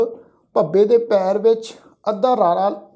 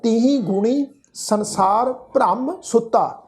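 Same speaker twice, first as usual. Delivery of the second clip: slow, measured Gurbani recitation with drawn-out vowels; scripture recitation; slower than usual. Shown as a demonstration. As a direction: recite the sacred line word by word, stretching the endings. Recites the verse for students. (ਹੌਲੀ, ਲਮਕਾ ਕੇ ਗੁਰਬਾਣੀ ਪਾਠ)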